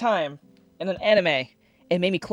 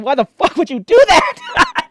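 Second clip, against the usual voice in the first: loud high drawn-out yell, slow; imitating a scream; 11 semitones higher, 11 dB louder, slower than usual. Ichigo yelling at the man cutting his eyes, imitated scream. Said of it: "Ichigo screamed in pain".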